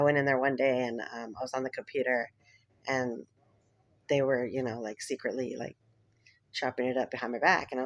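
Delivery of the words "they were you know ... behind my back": normal talking voice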